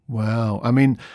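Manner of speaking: ordinary talk, nothing marked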